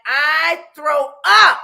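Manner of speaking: drawn-out loud throw up; slow loud outburst; loud slow groan. Real, not acted. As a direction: stretch it out, loud and disgusted. Disgust at curry smell.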